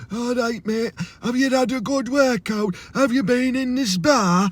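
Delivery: high, put-on chatty old man, lightly voiced; imitating old bloke. Naked old bloke chats to the lad, high put-on cheery voice. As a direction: cheerfully greet him mid-towelling. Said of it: "As he starts talking to me".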